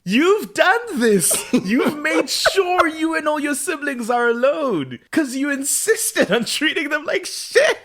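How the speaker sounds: high, pleading mock outrage at Klaus; exasperated plea to Klaus. Fan scolding Klaus for treating siblings badly, high exasperated plea. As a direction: beg him to see what he's done, way up high. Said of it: "And it's like".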